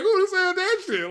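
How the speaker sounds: high and laughing; laughing retort; laughing disbelief. Retold or imitated. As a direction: ask it while cracking up. Stunned comic on stage.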